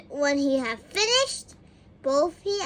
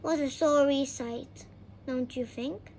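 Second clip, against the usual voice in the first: ordinary delivery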